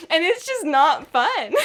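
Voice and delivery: ordinary talk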